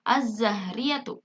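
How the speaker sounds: slow "az-zahriyatu" with a long Z; silent lam. Shown as a demonstration. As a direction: read "az-zahriyatu" deliberately, lam merged into Z. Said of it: "Kalian bisa ikuti saya".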